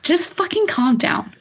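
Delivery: high-pitched mock exasperation; exasperated retort. Retold telling-off of bratty onlookers.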